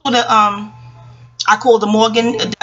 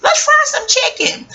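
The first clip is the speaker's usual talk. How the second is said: much higher, slow, laughing call; partygoer's call; slower than usual. Retold or imitated. Grown-up at a Harlem house party suggests frying chicken, high and laughing. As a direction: sing it out with a laugh, ready to party. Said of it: "Somebody say".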